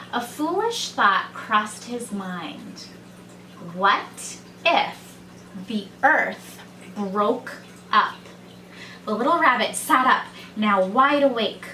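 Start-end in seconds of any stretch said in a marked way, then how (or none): none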